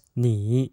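你 low, dipping then lifting; third tone. Shown as a demonstration. Teacher models 你 for repetition, low third tone. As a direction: dip 你 down low and draw it out. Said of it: "N I with a third tone".

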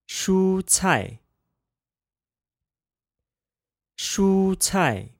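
蔬菜 said shū cài, high level then falling; 蔬菜 with first tone then fourth tone; practicing tones. Shown as a demonstration. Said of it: "first tone, neutral tone pair".

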